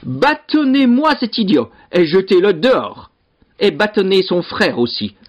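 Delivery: high, emphatic angry orders; storming rage. A narrator's reading. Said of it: "tempêta le seigneur".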